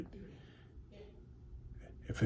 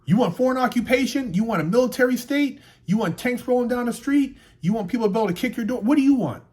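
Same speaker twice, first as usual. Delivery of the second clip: high, loud rapid-fire questions; heated challenge; 9 semitones higher, 6 dB louder than usual. Real, not acted. Challenging those who want America to fall, heated. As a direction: hammer the listener with angry questions.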